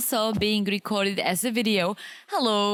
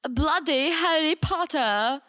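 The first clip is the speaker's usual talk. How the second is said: slow, higher mock British; British accent; 5 semitones higher, slower than usual. Shown as a demonstration. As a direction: lay on a hammy British accent. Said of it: "I'll give you some British accent".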